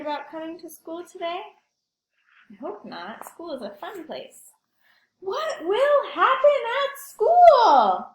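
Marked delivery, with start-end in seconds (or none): 5.2-8.1 high, loud, slow worried cry; anxious wail. Acted. The pigeon wails his worry about school to the class. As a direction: wail the question out.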